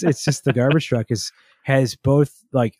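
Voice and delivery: usual speaking voice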